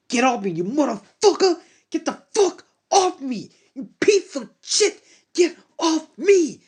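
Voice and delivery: very high, loud vicious yells, slowing at the end; furious screaming. Acted. Hero kicks the woman off, furious high screaming. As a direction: scream with pure rage and disgust.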